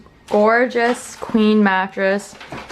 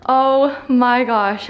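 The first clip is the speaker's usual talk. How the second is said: high, loud, drawn-out celebration; real excited cheer; 8 semitones higher, 5 dB louder, much slower than usual. Real, not acted. Vlogger celebrating the couch in the new apartment, excited. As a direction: stretch the words out in jubilant triumph.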